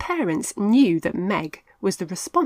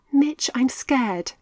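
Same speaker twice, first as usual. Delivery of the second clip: slow, higher frightened whisper; scared whisper; 6 semitones higher, 3 dB louder than usual. A narrator's reading. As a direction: tremble out your fear. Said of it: "Meg said".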